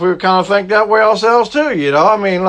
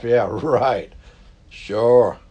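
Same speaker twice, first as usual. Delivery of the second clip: low, softer sarcastic chuckle; mock sarcastic yeah right; laughing it off; 6 semitones lower, 5 dB quieter, much slower than usual. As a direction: scoff at the prophecy with a laugh. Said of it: "we'd probably kinda laugh".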